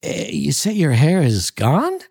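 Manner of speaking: a touch of a dumbfounded Jim; asked in a blank daze; dazed, almost idiotic. A narrator's reading. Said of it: "with an air almost of idiocy".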